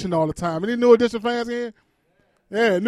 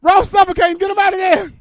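panicked shout; 8 semitones higher, 8 dB louder than usual. Retold or imitated. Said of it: "It was like".

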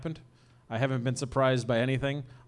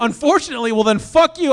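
loud, high playful outburst; comic indignation, pitched up loud; mock indignation; 9 semitones higher, 11 dB louder than usual. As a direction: throw it back in mock outrage. Mock offence at co-host's remark.

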